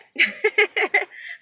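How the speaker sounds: a short high laugh at her own sadness; rueful laugh. Real, not acted. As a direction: laugh it off sheepishly. Rueful laugh between repeats.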